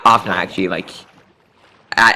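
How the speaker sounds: normal talking voice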